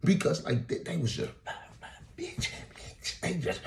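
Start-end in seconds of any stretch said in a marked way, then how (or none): none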